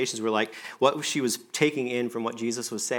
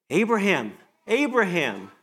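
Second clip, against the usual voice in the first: higher and louder calling of the name; urgent call; 6 semitones higher, 5 dB louder than usual. Acted. God calling Abraham at the sacrifice, urgent. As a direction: call out to stop him. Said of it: "when he was about to sacrifice his son".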